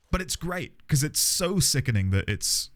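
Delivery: ordinary delivery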